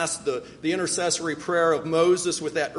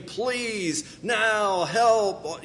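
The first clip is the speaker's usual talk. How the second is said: emphatic plea; 4 semitones higher, much slower than usual. Shown as a demonstration. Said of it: "an emphatic word".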